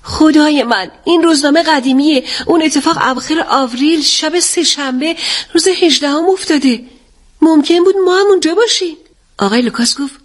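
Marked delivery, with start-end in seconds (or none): 0.0-9.0 high-pitched, alarmed exclamation; loud exclamation. A narrator's reading. Daughter realises they could have died there, loud alarmed exclamation. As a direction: exclaim in mounting shock at a near miss. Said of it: "اتل با صدای بلند گفت".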